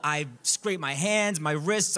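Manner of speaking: ordinary talk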